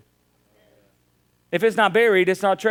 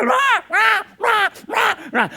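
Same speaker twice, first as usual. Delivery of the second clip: put-on high-pitched baby squalls; imitated baby wailing; slower than usual. Dad mimicking his baby losing his mind, shrill wails. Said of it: "he loses his dang mind".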